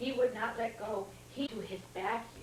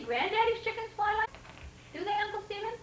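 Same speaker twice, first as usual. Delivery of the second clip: high questioning voice, "Granddaddy's" rising; questioning rise; 9 semitones higher, faster than usual. Real, not acted. Reader asking the child about chickens, rising. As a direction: ask brightly with a rise on "Granddaddy's". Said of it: "asks the child".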